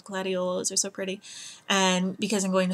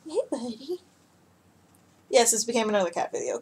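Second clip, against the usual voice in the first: ordinary talk, nothing marked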